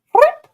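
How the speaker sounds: very high loud chirp back at the bird; bird chirp imitation. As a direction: mimic the bird's squeak, high and loud. Imitating the bird's call.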